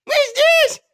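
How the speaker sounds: very high, loud squeaky rabbit voices; tiny rabbits. A narrator's reading. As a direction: pipe up like little bunnies. Rabbits answer doctor.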